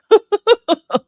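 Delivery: squealing fall of laughter; high cackling laugh. Real, not acted. Streamer done in by the gloves, cackling. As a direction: cackle and fade.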